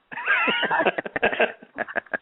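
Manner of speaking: high-pitched burst of laughter; long high laugh falling away; real laugh. Real, not acted. Laughs at being asked about weather.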